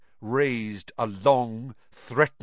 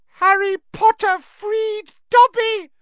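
very high, loud, shrill elf; piercing, jubilant elf voice; shrill Dobby; 10 dB louder than usual. A narrator's reading. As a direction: cry it out shrilly with joy. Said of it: "said the elf shrilly".

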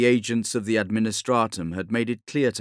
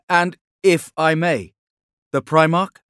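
higher, louder careful question; cautious advisor; 7 semitones higher, 8 dB louder than usual. Acted. Von Calder asks cautiously.

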